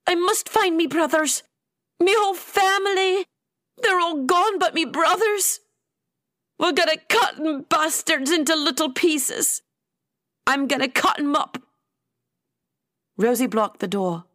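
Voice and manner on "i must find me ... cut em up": high-pitched, agitated character voice; vengeful rant. A narrator's reading. Atia swearing revenge for her family, vengeful rant. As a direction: rage through it, pitched well up.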